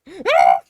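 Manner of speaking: sudden loud laugh; burst of laughter. Real, not acted. Loud laugh while introducing the stories.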